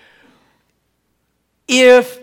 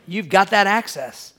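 usual speaking voice

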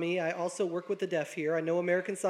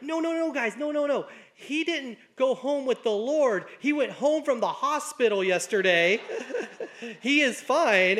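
higher, laughing "no, no, no, guys"; laughing correction; 6 semitones higher, 5 dB louder than usual. Retold or imitated. Teacher laughing as he corrects the grieving class. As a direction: cheerfully clear up the mix-up, laughing. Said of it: "he's like".

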